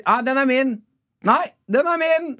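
high-pitched possessive argument; imitated squabbling. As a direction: bicker over it, stretching the last claim. Imitated quarrel over parking spot.

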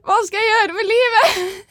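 high, drawn-out wail; slow, hammed-up existential whimper; mock crying. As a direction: retell a despairing wail, half laughing. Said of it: "begynner å gråte".

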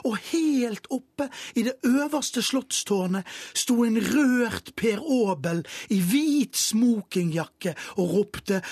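ordinary delivery, nothing marked

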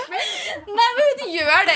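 high laughing squeal. Real, not acted. Teasing her friend about being pick me, high laughing squeal.

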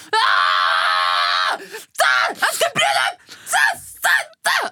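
hammed-up crying meltdown; freaking out crying. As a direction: break down shouting in panic. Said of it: "klikket litt fra meg og vært sånn".

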